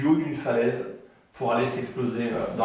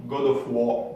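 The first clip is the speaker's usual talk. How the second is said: God of War in a hammed-up English accent; mock English accent on God of War; slower than usual. Shown as a demonstration. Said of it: "mon accent anglais qui déchire".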